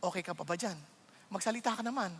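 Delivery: soft, low-pitched teasing check-in; asking your neighbour. Shown as a demonstration. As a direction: murmur it to the person beside you. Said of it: "Pakisabi sa katabi mo". (mahinang tanong sa katabi)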